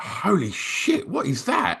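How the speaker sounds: high, drawn-out disbelief without extra volume; awestruck amazement. Retold or imitated. Recalling schoolmates first seeing a graffiti book, awestruck. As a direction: marvel slowly, pitch lifted right up.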